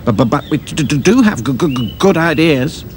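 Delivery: stuttering on each start; stammering. A narrator's reading. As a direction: stammer it out nervously. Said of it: "stammered Hi-Fi".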